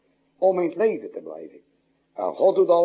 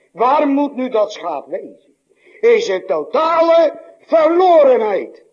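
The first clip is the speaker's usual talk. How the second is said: higher, louder, slow pulpit climax; preaching at full force; 7 semitones higher, 6 dB louder, slower than usual. Acted. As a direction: build to the peak of the sermon, slow and loud. Climax of the sermon. (luid en hoog preken, langzaam naar de climax)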